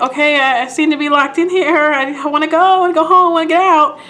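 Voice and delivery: high, rising plea to get out; mock pleading. Retold woman locked in store.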